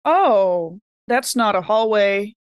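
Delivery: ordinary talk, nothing marked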